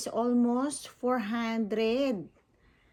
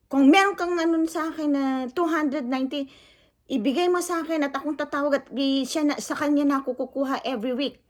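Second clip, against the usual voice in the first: higher, louder, fast challenging boast; imitating the owner; 4 semitones higher, 4 dB louder, faster than usual. Vlogger mimics the shop owner challenging her, loud and fast. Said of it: "Sabi niya, ganon".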